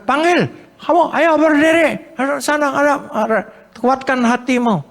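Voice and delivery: a high, eager call to get up; eager urging. Acted. Crowd telling the beggar to rise, high and eager. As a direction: urge him on, high and eager.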